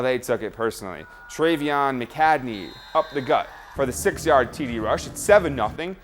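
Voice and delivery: usual speaking voice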